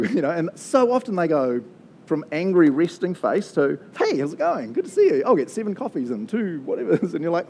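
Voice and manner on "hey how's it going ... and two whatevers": cheery customer. Retold or imitated.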